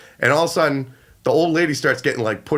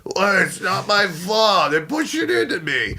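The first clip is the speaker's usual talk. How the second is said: high, drawn-out caricature of the mother; complaining mother; 5 semitones higher, slower than usual. Retold or imitated. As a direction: do a put-on voice of a protesting old mother. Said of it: "the mother actually did sound like this".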